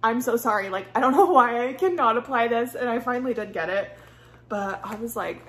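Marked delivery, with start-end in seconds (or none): 0.0-2.8 frantic, loud apologizing; loud exasperated sorry; loud flustered apology. Retold or imitated. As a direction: blurt out the apology to the editor. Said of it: "being like".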